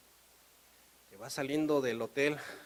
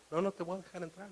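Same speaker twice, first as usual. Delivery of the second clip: softer, higher refusal; unmoved official; 4 semitones higher, 6 dB quieter, faster than usual. Acted. Customs officer to insistent painter, soft refusal. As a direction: turn them down quietly.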